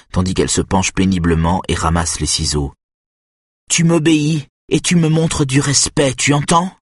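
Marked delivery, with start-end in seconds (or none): 3.7-6.8 autorité fâchée. A narrator's reading. Mother demands obedience.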